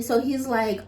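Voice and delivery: usual speaking voice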